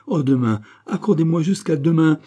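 loud, high, urgent appeal; pleading exclamation. A narrator's reading. Queen begs the king to wait, pleading exclamation. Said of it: "s'écria-t-elle".

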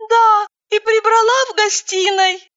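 timid, high and small voice. A narrator's reading. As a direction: answer shyly, high up. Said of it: "робко ответила".

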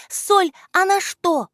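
put-on high, slow girlish question; girl's voice. A narrator's reading. Alya ponders salt with her friends: high girlish question. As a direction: wonder it out loud as a little girl.

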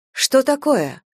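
high-pitched, concerned question; alarmed friend checking on her; worried question. A narrator's reading. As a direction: ask in sudden concern, voice lifted. Friend alarmed at narrator's distress.

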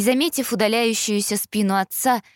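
ordinary delivery, nothing marked

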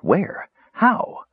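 high, bewildered cry rising in alarm. A narrator's reading. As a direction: cry out lost and frightened. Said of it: "Danubis cried".

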